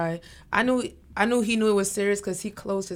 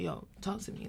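soft invite; 16 dB quieter than usual. Retold or imitated. Friend reaching out gently.

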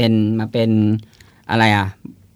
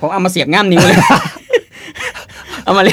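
laughing through the words, higher pitch; 6 semitones higher than usual. Real, not acted. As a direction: crack up while telling the joke. Joking about chopstick claws.

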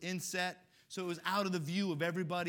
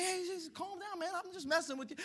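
scared bully; 12 semitones higher than usual. Retold or imitated. Scared bully pleads with the boy in a much higher voice. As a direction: plead to be let off.

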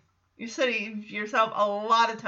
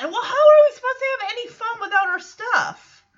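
Jackson whining; 10 semitones higher, 4 dB louder, faster than usual. Retold or imitated. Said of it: "Jackson is like".

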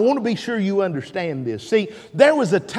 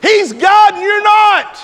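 much higher, louder rebuke; preaching shout; 11 semitones higher, 5 dB louder than usual. Real, not acted. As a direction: thunder it at the congregation.

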